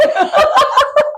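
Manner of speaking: high, bubbly burst of laughter; high giggle burst. Real, not acted. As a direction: giggle high and bright, caught off guard. Laughs at host's praise.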